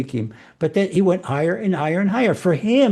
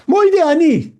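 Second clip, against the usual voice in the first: a sudden high, loud burst of morning zeal; spring out of bed with it; eager wake-up call; 10 semitones higher, 5 dB louder than usual. Retold or imitated. Teacher voicing the eager inner voice, a loud high cheer. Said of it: "that little voice that says".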